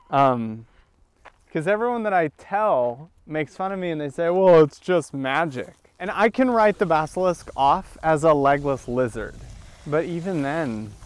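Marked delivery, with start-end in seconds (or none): none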